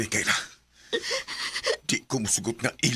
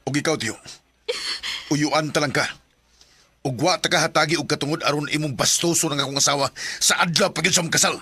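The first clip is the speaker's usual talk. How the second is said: louder, rising angry scolding; angry rebuke; 4 semitones higher, 3 dB louder than usual. Acted. Robert scolding his uncle for insulting wife, angry. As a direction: rebuke him with mounting anger.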